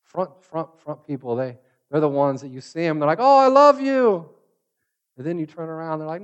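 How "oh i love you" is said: fake sweet. Fake sweet front person.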